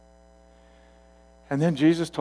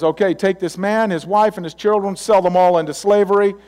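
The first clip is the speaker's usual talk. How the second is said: commanding order; 6 semitones higher, 5 dB louder than usual. Acted. King ordering his aides.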